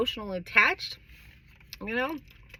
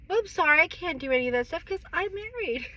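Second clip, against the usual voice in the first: mock excuse; 7 semitones higher than usual. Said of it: "it's like".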